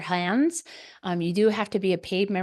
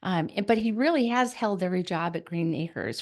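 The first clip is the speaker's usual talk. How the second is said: usual speaking voice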